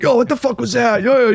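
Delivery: high, loud hyped-up teammate picking a fight; aggressive teammate. Retold or imitated. Senior picking fights at practice, high hyped yell. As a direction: play the senior shoving guys, yelling high.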